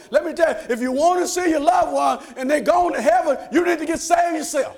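loud, high, driving preacher cadence; fiery preaching. Acted. Preacher urging the congregation to get saved, fiery preaching. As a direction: build the congregation up, full force.

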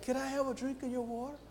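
high, hushed acted plea; soft polite request. Servant's timid request voiced in story.

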